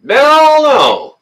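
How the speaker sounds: high, slow, drawn-out big no; a booming, playful big ol' no; hammed-up no. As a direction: stretch it out like a game-show verdict. Reading out chat votes with gusto.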